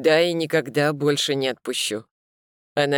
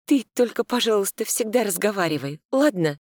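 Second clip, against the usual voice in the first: lightly voiced woman, higher; gentle plea in a woman's voice; woman's pleading voice; 6 semitones higher than usual. A narrator's reading. Inga pleads with Misha.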